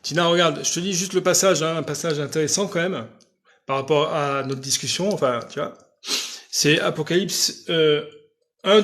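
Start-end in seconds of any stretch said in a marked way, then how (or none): none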